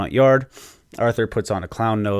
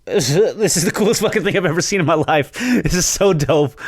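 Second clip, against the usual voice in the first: higher, louder, laughing through it; laughing fanboy; 9 semitones higher, 4 dB louder than usual. Retold or imitated. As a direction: gush like a thrilled fan.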